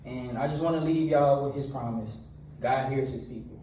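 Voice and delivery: energetic church talk; preaching. Acted. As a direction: preach it to the young crowd with energy. Youth sermon with retold story.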